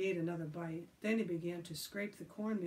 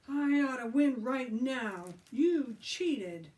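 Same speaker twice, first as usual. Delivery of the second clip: loud, slow angry accusation; 5 semitones higher, 8 dB louder, slower than usual. Acted. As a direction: insist angrily. Billy angry.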